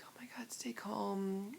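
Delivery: slow, soft whisper to yourself; steady yourself under your breath; hushed, drawn-out inner plea; whispered self-talk. Retold or imitated. Whispered self-talk to keep calm.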